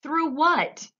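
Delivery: high, loud alarmed question; alarmed shout. A narrator's reading. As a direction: panicky call. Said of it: "shouted Grandpa Joe".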